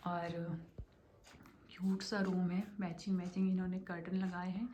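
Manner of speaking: soft, half-whispered room tour; hushed talk. Real, not acted. Talking quietly beside sleeping Swarit so he won't wake, hushed. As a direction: keep it down, almost whispering. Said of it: "थोड़ी धीरे धीरे बात करूँगी".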